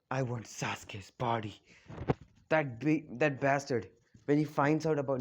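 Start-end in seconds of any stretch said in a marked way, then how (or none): 0.1-1.6 low, soft, slow sinister voice; creepy villain. Retold or imitated. Orochimaru craving Sasuke's body, low, soft and slow. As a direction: purr it like a hungry snake. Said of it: "He's like".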